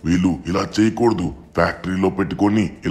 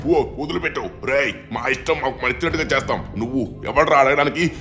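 defiant villain; 7 semitones higher than usual. Acted. Villain fights back against the intruder.

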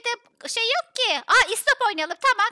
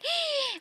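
high, soft gasp; shocked gasp; 6 semitones higher, 6 dB quieter than usual. Pretend. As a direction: draw in a startled breath. Shocked that Nazo is missing. (şaşkınlıkla iç çekme)

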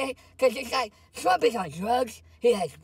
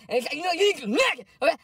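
ordinary talk

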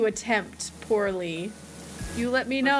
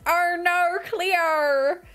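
higher, drawn-out mock Australian vowels; Aussie accent; 5 semitones higher, much slower than usual. Streamer attempting the requested accent, hammed-up Aussie. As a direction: exaggerate the Aussie "oh, no". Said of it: "I'll do my best".

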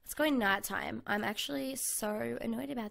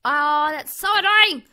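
loud frustrated yell at the dead end; annoyed outburst; 3 semitones higher, 14 dB louder than usual. Real, not acted. Gamer yelling at a cave that ends, loud and annoyed. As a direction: blurt it out, fed up and loud.

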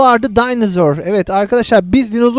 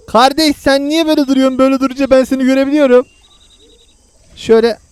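usual speaking voice

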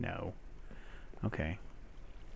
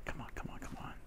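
whispering; 14 dB quieter, faster than usual. Real, not acted. Willing the game choice.

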